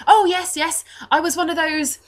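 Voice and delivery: put-on high, emphatic boy, loud and keen; Reese. Altar boy asked about his upbringing, high keen reply. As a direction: pipe up eagerly in a child's voice. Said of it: "twelve-year-old-ish looking blonde altar boy".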